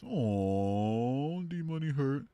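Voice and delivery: high cooing aww; baby him mockingly; mock pity.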